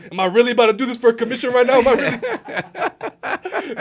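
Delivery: fast then drag the last words out in laughing disbelief. Retold or imitated. Agent questioning the listing.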